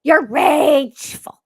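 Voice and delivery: high, loud, drawn-out mock accusation; hammed-up, stretched-out finger-pointing cry; mock accusers. Mocking people who call her rageful, loud exaggerated accusation. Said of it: "I love the when people say".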